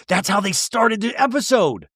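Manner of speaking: ordinary talk, nothing marked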